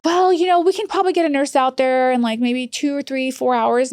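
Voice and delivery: high-pitched mock official, hedging; imitated stalling worker. Agency worker putting off the daughter's plea, mocked.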